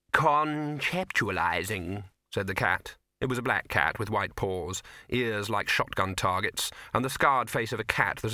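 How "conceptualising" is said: drawn-out, self-important cat voice; cat character. Acted. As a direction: stretch the word out slowly as the cat.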